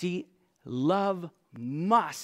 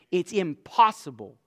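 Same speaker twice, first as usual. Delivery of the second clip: usual speaking voice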